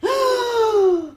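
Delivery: long, high voiced intake of breath; reviving gasp. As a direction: gasp back to life. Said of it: "She takes another breath".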